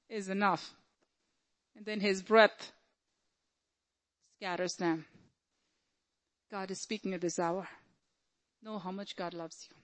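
very soft, measured assurance; hushed preaching. Real, not acted. Preacher assuring believers God will fight for them, hushed. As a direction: bring it right down and let each phrase settle.

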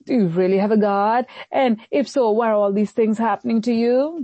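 ordinary talk

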